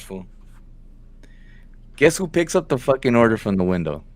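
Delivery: ordinary delivery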